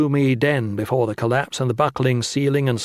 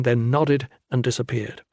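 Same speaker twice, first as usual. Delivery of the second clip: ordinary delivery, nothing marked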